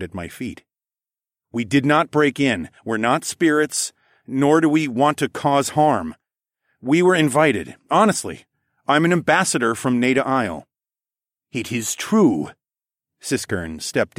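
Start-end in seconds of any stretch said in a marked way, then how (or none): 1.5-10.7 raised, louder voice pleading innocence; urgent protest. A narrator's reading. Ambassador defending his party to captors, urgent protest. 11.5-12.5 regal regent. A narrator's reading. Regent backs the ambassador.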